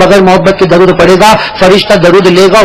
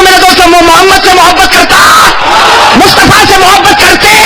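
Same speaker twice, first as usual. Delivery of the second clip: preaching at full force; 11 semitones higher, slower than usual. Acted. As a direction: lead the crowd's vow at full voice. Leads crowd in pledge.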